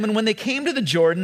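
ordinary talk, nothing marked

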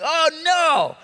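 high, loud panicked cry; alarmed "oh no". Retold or imitated. Student realizes the borrowed axe is gone, panicked cry. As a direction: gasp it out as if the axe just sank. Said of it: "it's like".

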